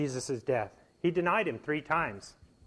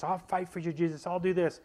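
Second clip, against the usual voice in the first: lightly voiced, higher eager Peter; a hint of brash promising, pitched up; impetuous Peter; 4 semitones higher than usual. Preacher voicing impetuous Peter's eager vow, lightly. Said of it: "he was so impetuous".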